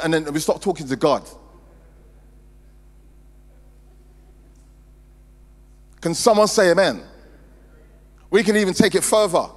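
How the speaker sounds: urgent exhortation from the pulpit; forceful preaching. Real, not acted. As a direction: challenge the congregation with punchy rhetorical questions. Exhorts the congregation.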